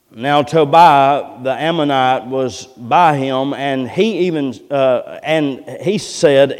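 slow, measured pulpit reading; scripture reading. Acted. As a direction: read it out deliberately to the congregation. Reads scripture to the congregation.

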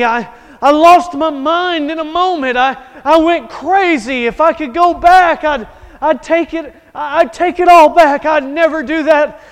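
high, loud, desperate pleading; a killer breaking down, loud and high; frantic confession. Acted. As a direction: cry out in panic and remorse. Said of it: "he's screaming, and he's frantic".

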